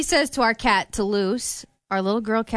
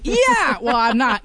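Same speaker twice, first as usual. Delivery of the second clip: ordinary delivery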